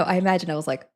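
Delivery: ordinary delivery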